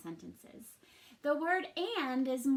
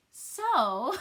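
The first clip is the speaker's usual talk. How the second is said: last louder giggles fading out; laughing; 5 semitones higher, 7 dB louder than usual. Real, not acted. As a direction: still chuckling, trying to recover. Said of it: "now I can't say but without laughing".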